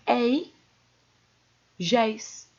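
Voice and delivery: "Ei, géis" with an open E gliding to I; open diphthong. Shown as a demonstration. Teacher demonstrates open diphthongs, open timbre. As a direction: open the E strongly in "Ei", then "géis". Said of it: "O timbre é aberto".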